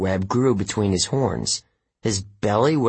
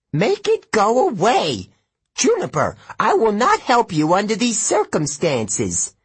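put-on panicky old satyr; 11 semitones higher, 3 dB louder than usual. A narrator's reading. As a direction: squeal at the beast to leave. Frightened satyr demands.

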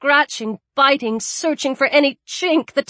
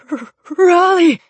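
stammered, louder cry with a catch; calling out; 6 semitones higher, 5 dB louder than usual. Acted. Freezing man calls out to his dog, stammering. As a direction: call the dog through chattering teeth.

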